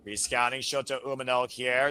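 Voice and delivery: ordinary talk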